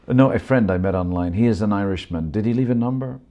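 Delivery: put-on brushing it off, a touch of Indian accent; light Indian accent. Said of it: "the Indian accent".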